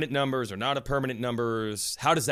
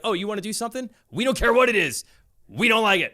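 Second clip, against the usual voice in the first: mock hostile; 7 semitones higher, 5 dB louder than usual. Voicing hostile teams in retold quarrel.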